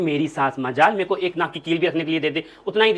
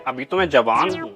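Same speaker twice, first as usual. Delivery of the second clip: lower, slow, proud drawl of a tipsy mother-in-law; boastful old mother-in-law; 5 semitones lower than usual. Acted. Mother-in-law caught drinking brags to daughter-in-law, slow and lower. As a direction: savour it like a tipsy old lady bragging.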